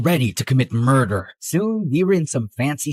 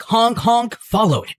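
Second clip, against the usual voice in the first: ordinary talk, nothing marked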